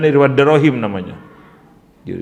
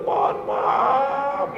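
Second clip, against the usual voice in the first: put-on high, wailing old man's weeping plea; an old cleric weeping to the teacher, begging forgiveness; crying; 14 semitones higher, slower than usual. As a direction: sob out the apology in a high, wavering voice.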